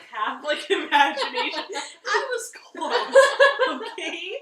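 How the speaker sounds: loud words breaking into high laughter; helplessly amused, very loud; laughing protest. Real, not acted. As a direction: burst out laughing while defending yourself. Laughing at own wrong guess.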